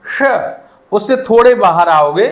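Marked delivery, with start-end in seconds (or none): 0.0-0.3 tongue turned in, let श whistle; whistling sibilant. Shown as a demonstration. Teacher confirming the real sound, retroflex श. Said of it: "ये वाली इसकी sound होती है रियल".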